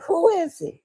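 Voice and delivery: high-pitched call to whoever's at the door; upset door call. Retold or imitated. Upset, calls through the door.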